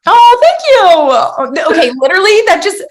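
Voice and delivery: real loud, high-pitched squeal of delight; delighted thanks. Real, not acted. Delighted by a compliment on looking young.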